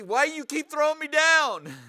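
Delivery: slow, loud and high mock yell; Balaam yelling at his donkey for throwing him; retold yell. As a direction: bellow it at a stubborn donkey. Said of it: "he was yelling at the donkey".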